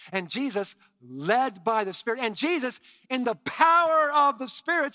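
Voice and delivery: a preacher climbing to a peak; building to a shout. Real, not acted.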